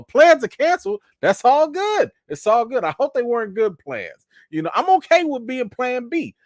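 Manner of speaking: high joking fake-wounded voice; mock hurt. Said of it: "Well, no, no joke with that".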